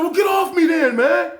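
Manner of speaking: high, loud yell to back off; angry shout. Retold or imitated. Inmate telling the guard to back off, loud angry shout. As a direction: bark it at someone who is helping. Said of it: "He was like".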